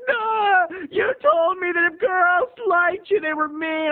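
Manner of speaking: high, slow crying whine; whiny teen crying. Retold or imitated. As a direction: whine to mom in tears. Whining to mom.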